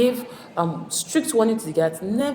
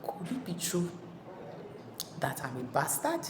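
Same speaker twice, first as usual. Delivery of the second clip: much softer, almost to himself; doubtful inner thought; 14 dB quieter than usual. Retold or imitated. Ajanaku alone after the quarrel, softly doubting himself. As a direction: wonder it quietly in your own head. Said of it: "Ajanaku has started thinking".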